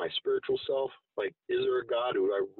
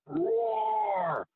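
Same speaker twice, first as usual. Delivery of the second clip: dragon roar; 11 semitones higher than usual. Retold or imitated. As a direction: let out a big monster roar. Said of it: "is roaring".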